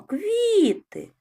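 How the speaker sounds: "Квіти" called out very high and loud, КВІ held long; calling out the word. Shown as a demonstration. Teacher calling the word so children hear the stressed syllable. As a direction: call "Квіти" like calling a friend across a yard. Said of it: "Покличемо".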